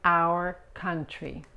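"Our" with a short A and English R, slowly; pronunciation demo. Shown as a demonstration. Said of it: "La A es corta, la R de inglés". ("Our" con la A corta y la R inglesa, despacio)

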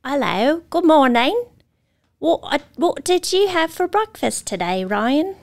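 high, put-on British accent. Shown as a demonstration. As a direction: go posh British on the greeting. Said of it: "sample of your British accent real quick".

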